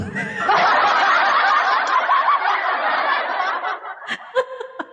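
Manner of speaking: high, breathy burst of laughter; cracking up live on stream; real laughter. Real, not acted. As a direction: laugh helplessly at the joke.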